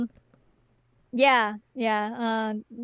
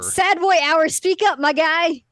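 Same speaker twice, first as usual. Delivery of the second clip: loud, raised-pitch demand; calling out; 4 semitones higher, 6 dB louder, slower than usual. Retold or imitated. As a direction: holler at a mumbler to be heard. Calling out to inaudible character.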